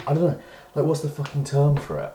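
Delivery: high, loud, frustrated groping for words; exasperated. Real, not acted. Ranting about Brexit to viewers, exasperated and loud.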